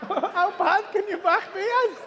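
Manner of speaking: high-pitched, loud comic disbelief; incredulous teasing. Real, not acted. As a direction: tease him in a loud, squeaky-high incredulous voice. Mock disbelief at baker's bad back.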